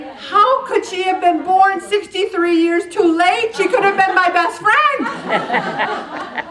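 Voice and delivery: high, laughing through the words; delighted laughter bubbling over. Real, not acted. Laughing at the child's drawing.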